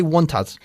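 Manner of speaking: WANT US run together as one word, "wontus"; linked words. Shown as a demonstration. Teacher showing the student linking, want us as one word. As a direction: glue WANT US into a single word. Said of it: "como si fuese una sola palabra".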